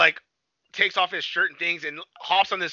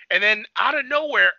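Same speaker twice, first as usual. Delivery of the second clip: usual speaking voice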